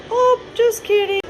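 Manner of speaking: very high squeaky just kidding then giggle; giggly squeal. Real, not acted.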